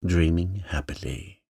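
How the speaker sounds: usual speaking voice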